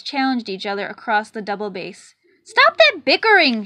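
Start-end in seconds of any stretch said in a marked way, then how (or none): 2.4-3.6 loud, high shout to stop the twins; furious conductor yelling over the bickering. A narrator's reading. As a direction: bellow them into silence. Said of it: "shouted the conductor".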